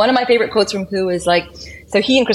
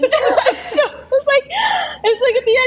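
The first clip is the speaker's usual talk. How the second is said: high pitched, laughing through the words; laughing hard. Real, not acted. Friend on a podcast, laughing as she recalls the hamburger scene. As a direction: crack up while talking.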